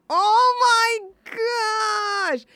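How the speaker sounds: very high, loud, slow drawn-out squeal; excited squeal. Real, not acted. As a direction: fangirl squeal, stretched out. Gushing over a Twilight clip.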